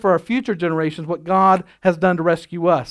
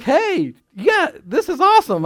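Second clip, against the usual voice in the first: put-on excited kid; 9 semitones higher, 3 dB louder than usual. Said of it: "And I was like".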